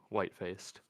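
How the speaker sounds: ordinary delivery, nothing marked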